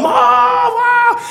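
very high, loud drawn-out cry; mock yelling for Mom. Dad mimicking his daughter calling for her mother, high loud mock scream. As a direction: imitate a child screaming for rescue. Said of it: "she's yelling for Mom".

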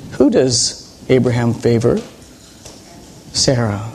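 usual speaking voice

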